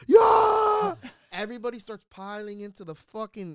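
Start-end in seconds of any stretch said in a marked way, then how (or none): 0.1-0.9 huge high whoop; locker room cheer. Retold or imitated. The whole room cheering the news, shouting. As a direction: cheer like a team that just won. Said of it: "like a football locker room".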